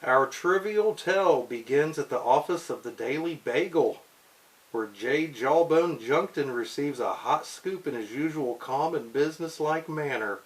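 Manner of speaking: lively storybook narration; comic narration. Acted. Reading a comic caption to a child, storybook voice.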